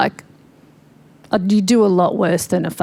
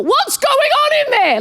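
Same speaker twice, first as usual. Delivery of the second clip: high, slow call through a door; mock husband shouting, much higher and slow; shouted through door; slower than usual. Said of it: "he shouted through the door".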